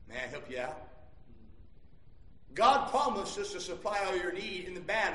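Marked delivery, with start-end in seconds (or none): 0.1-0.9 very low, soft aside; gentle offer. Real, not acted. Preacher to the worried congregation, gentle offer. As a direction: drop right down to offer help.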